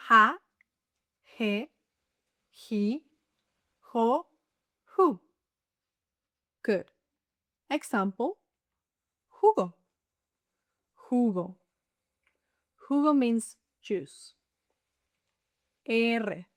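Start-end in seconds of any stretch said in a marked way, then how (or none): 0.1-5.2 JA, JE, JI, JO, JU each opened with a strong breathy H; spaced syllables, each J a puff of breath; strong breath sound. Shown as a demonstration. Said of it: "a strong breath sound". 11.1-11.6 drawn-out demonstration. Shown as a demonstration. Word repeated slowly. 15.9-16.4 R said slowly as a long "eeh-reh"; slow, level "R" for learners; drawn-out letter name. Shown as a demonstration. Letter name drawn out, then normal.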